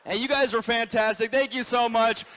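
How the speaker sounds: loud, high, building thanks; stage sign-off. Real, not acted. Comic thanking the crowd at show's end, loud projection. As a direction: call out to the whole room over applause.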